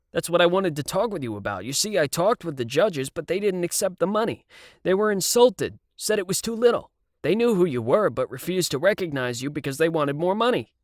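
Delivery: talking his way out of trouble; a hint of a slick producer. A narrator's reading. Producer explains to Siv.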